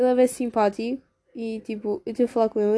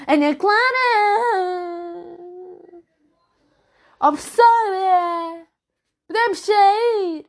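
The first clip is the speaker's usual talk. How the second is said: put-on higher, louder, slow caricature of a yelling girl; loud classmate impression; 7 semitones higher, 3 dB louder, slower than usual. Imitating the girl who always yells at the teacher, loud and slow. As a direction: drag out the teacher's name at full volume. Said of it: "Sempre aos berros".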